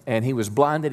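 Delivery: usual speaking voice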